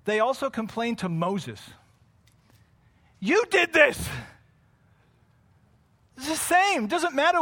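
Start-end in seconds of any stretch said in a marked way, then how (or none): 3.2-4.1 loud, high mock accusation; raised-voice, acted finger-pointing. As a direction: hurl blame like an outraged crowd. Voices people blaming Moses.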